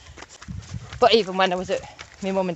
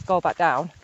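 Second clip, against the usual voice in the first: normal talking voice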